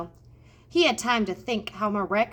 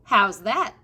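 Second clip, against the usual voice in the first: a touch louder, calling back; lightly raised voice, not a full shout; mild shout; 5 dB louder than usual. A narrator's reading. Mig calling across the kitchen, mildly raised voice. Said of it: "shouted Mig".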